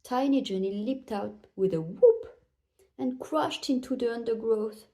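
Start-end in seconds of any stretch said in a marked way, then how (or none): none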